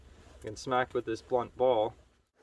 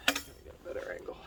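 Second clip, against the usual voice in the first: muttering under breath; 12 dB quieter, much faster than usual. Real, not acted. Muttering to self while sawing.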